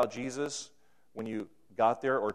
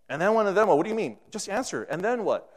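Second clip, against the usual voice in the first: frustrated; 8 semitones higher, 7 dB louder, faster than usual. Retold or imitated. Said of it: "He's, like, frustrated".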